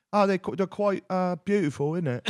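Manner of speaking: high-pitched parody of a British bloke; mock British accent. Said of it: "just British people talking like".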